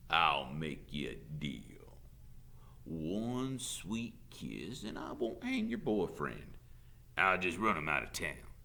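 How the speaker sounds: slow, leering Southern drawl; wheedling sheriff. A narrator's reading. Sheriff bargaining with teacher for kiss, drawling. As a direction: dangle the deal lazily.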